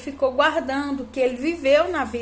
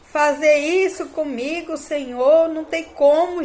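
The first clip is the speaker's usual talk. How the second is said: high, loud reenacted plea; loud cry to God; 5 semitones higher, 4 dB louder than usual. Mother reenacting her protest to God about her son, loud. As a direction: cry out in protest to heaven, pitch raised. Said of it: "falei muito alto, falando com Deus".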